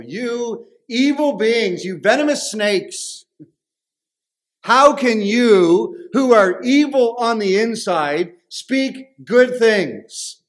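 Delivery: slow, high-pitched, thundering rebuke; Jesus. Acted. Jesus rebuking the Pharisees as venomous snakes, slow emphatic denunciation. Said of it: "the way that Jesus speaks to the Pharisees".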